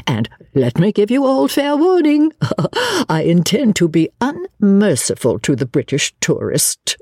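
high, theatrical mock threat with a little laugh; gleeful warning. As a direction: swoop up playfully, then titter. Novelist gleefully warns of satire.

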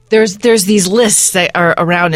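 ordinary talk

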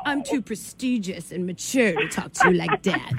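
haughty cat voice. Acted. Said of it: "And then Silvio, when he answers me".

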